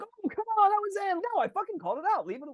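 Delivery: high, whiny protest at the line call; mock a sore player moaning about a call; whiny complainer. Imitating player who complains every call.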